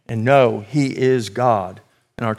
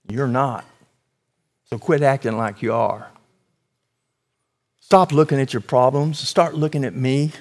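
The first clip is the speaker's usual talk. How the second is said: quietly reassuring God answering the anxious believer; calm God; 3 dB quieter than usual. Acted. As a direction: soothe a frightened child. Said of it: "calmly with something like this".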